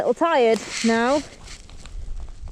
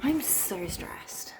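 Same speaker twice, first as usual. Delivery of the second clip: whisper; 4 semitones lower, 20 dB quieter than usual. Real, not acted. Lost item, stressed hushed talk.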